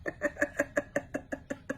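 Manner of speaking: breathy squeaky laugh; high airy giggle. Real, not acted. Laughing at mom's quote.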